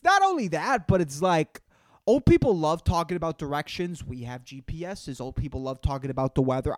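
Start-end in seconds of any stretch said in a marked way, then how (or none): none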